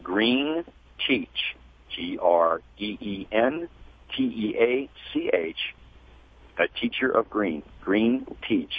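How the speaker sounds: very slow email dictation; deliberate, unhurried, each part set apart; slow and clear. Real, not acted. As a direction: spell it out letter by letter, with pauses. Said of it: "say it slowly and clearly".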